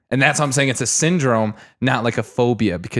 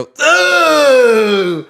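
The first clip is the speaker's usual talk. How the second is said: loud, high "ugh" of disgust; exaggerated retching disgust; mock disgusted groan; 11 semitones higher, 6 dB louder than usual. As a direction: groan loudly as a grossed-out kid. Imitating disgusted schoolmates.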